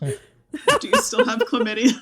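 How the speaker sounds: mock-accusing question, laughing; teasing. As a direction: needle her with a grin.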